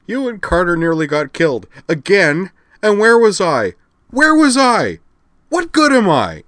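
very loud, high wail of self-reproach; distraught bawling. A narrator's reading. Rena blames herself after friend nearly died, bawling. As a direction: escalate to an anguished high shout. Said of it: "bawled Rena Dragonar".

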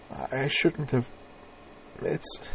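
slow, soft, broken words; raw misery. A narrator's reading. Woman miserably apologizing to Corwin. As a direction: let the misery choke it. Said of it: "tone of raw and complete misery".

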